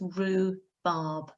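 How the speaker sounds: "rhubarb" said slowly as roo-barb, no H sound; teacher sounding out "rhubarb" without the H; silent H. Shown as a demonstration. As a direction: draw out "rhubarb" with no H, oo in the middle. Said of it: "the U is making the oo sound".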